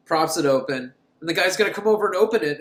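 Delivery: ordinary delivery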